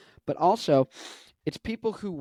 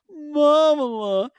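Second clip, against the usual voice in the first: high, loud, drawn-out name; loud, slow caricature of Kamala; Kamala impression; 10 semitones higher, 7 dB louder, much slower than usual. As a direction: stretch it out high in exaggerated mimicry. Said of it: "you need to do the voice".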